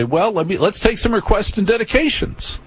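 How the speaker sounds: high, rising mock on-air patter. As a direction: imitate a DJ gamely inviting requests. Acts his chirpy on-air DJ pitch.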